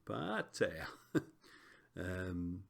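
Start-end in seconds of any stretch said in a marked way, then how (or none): 0.1-0.9 "Pater" with an overdone pitch swoop; sing-song "Pater", tone exaggerated on the accent; exaggerated rising tone. Shown as a demonstration. Teacher demonstrating Greek pitch accent for students, exaggerated rising tone. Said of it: "they think is rising".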